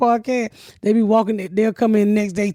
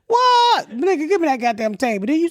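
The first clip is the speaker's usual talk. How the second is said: outraged disbelief at the price; mock outrage; 7 semitones higher than usual. Haggling with seller over table.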